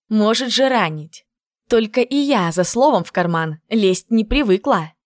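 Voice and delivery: plain reading voice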